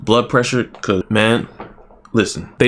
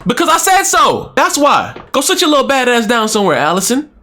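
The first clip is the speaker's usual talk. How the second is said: angry parent scolding; 12 semitones higher than usual. Pretend. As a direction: snap at the kid to sit down. Scolding a child character.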